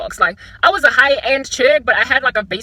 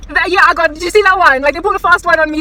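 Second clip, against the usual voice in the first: high, loud disbelief; loud indignant outburst; 6 semitones higher, 7 dB louder than usual. Real, not acted. Driver outraged someone took her spot, loud. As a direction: exclaim at the nerve of it. Reacting to spot being taken.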